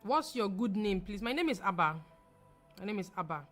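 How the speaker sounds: ordinary talk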